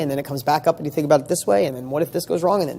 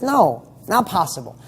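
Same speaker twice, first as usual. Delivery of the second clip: normal talking voice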